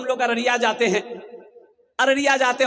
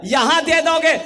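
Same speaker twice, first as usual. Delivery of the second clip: loud, high preacher's call; rousing appeal; 7 semitones higher, 7 dB louder than usual. Real, not acted. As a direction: drive the appeal home at full force. Preacher urges giving at full force.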